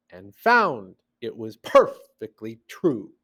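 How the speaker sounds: slow recital with WAS, MAN, pe-RU and each strong beat swooping up; measured, exaggerated limerick lilt; metrical limerick reading. Shown as a demonstration. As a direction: recite deliberately, leaning hard on every stressed beat. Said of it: "So let's read it again".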